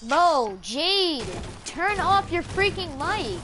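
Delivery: slow, loud, fed-up demand; angry. Real, not acted. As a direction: snap it out, patience gone. Repeated demand, now shouting.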